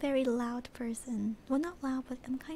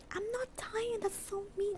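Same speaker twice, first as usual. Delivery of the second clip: high whiny complaint; whiny protest; 8 semitones higher, 4 dB quieter than usual. Real, not acted. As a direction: whine in protest. Protesting chat teasing.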